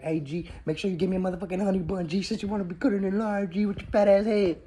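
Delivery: high, loud mock yell at a line cutter. He yells at someone cutting the line, loud high mock yell. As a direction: bark it at someone who cut in line.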